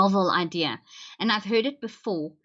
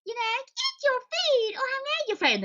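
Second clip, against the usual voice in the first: much higher, sugary cajoling mother; overbearing mom. Retold or imitated. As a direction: coax the child like a cloying parent. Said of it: "when parents are too overbearing".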